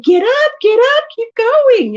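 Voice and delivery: high, loud rallying call; slow, high-pitched shout of encouragement; urging on. Retold or imitated. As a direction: cheer a fallen kid back up. Urges fallen child on, retold.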